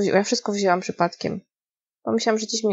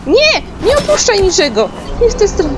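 high, loud urgent yells; frantic shouting; 8 semitones higher, 6 dB louder than usual. Real, not acted. Gamer shouting at her character during the chase, frantic. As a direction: yell orders in a frantic rush.

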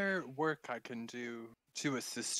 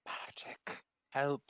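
whisper; slower than usual. Acted. Player hushes a question.

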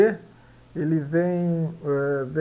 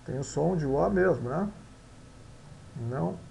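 ordinary talk, nothing marked

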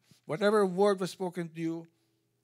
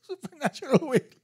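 high laughing disbelief; amused high laugh through the phrase; 12 semitones higher, 9 dB louder than usual. Real, not acted. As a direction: laugh the words out, louder and higher. Laughing at the request.

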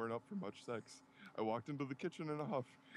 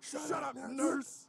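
high-pitched, loud comic shout; 12 semitones higher, 6 dB louder, much slower than usual. Acted. Annoyed man tells the nurse off, shouting. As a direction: yell it to shut someone up. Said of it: "He shouted".

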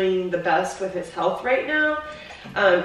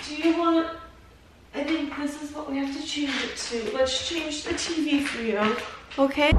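higher, softer pet talk; talking to her pet; 6 semitones higher, 8 dB quieter than usual. Real, not acted. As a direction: coo gently to the dog before leaving.

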